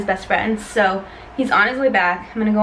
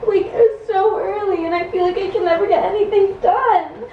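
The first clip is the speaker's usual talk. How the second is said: fake panic attack; 8 semitones higher, 3 dB louder than usual. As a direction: fake a breakdown, voice cracking high. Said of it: "then I'll start the panic attack".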